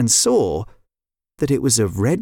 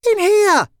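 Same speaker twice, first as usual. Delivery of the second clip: high, louder answering call; calling back; 5 dB louder than usual. A narrator's reading. Neve answers her sister from a bedroom, calling back. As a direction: call back through a door. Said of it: "Neve called back".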